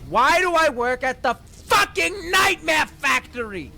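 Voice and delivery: high, loud wail of frustration; real exasperated outburst; despairing shout. Real, not acted. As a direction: yell it at the sky. Despairing rant about his job.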